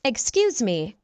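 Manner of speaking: ordinary talk, nothing marked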